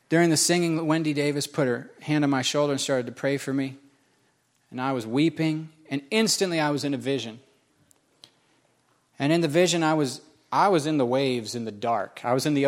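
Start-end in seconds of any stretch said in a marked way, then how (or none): none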